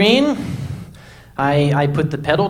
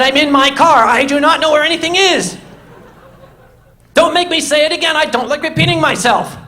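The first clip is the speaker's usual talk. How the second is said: loud, higher, fed-up yelling into the phone; exasperated shouting; 9 semitones higher, 4 dB louder than usual. Retold or imitated. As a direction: bark it like you've lost patience. Frustrated caller on phone.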